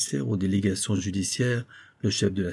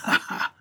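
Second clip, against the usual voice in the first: mocking laugh. Poisoner mocks the prefect.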